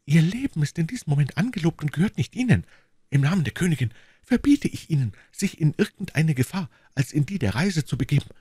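high, urgent female character voice; impassioned order from the young woman; commanding young woman. Acted. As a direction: forbid him firmly and urgently.

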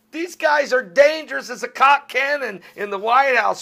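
high, loud, fired-up radio rant; heated rant. Real, not acted. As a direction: hammer the warning home like a preacher. On-air rant about Russia and Biden.

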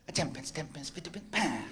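quiet muttered step count; soft counting again. Acted. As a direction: count under your breath. Mate repeats the count.

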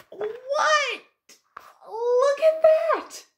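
hammed-up gasp of wonder; mock astonished cry to the listeners. Sudden surprise at picture.

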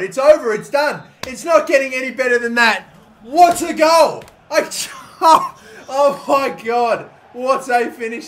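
exultant, can't believe it; elated shouting. Real, not acted. Replaying goal joy.

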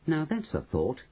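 mildly cheered, pitch lifted; perking up. A narrator's reading. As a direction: brighten slightly at a tempting idea. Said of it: "perking up a bit".

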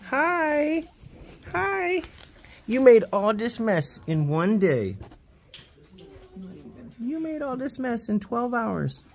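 high sing-song pet voice. Real, not acted. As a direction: coo up high at the little animal. Talking to a messy guinea pig.

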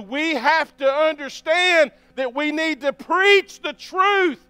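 revival-style sermon push; fired-up preaching. Acted. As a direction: preach it out to the whole room. Preaching at full force.

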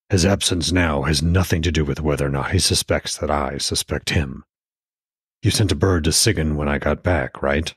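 put-on understated older husband voice; Odin. A narrator's reading.

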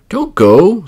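high, loud plea; woodman pleading. Acted. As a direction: woodman begging her to stay. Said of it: "said the woodman".

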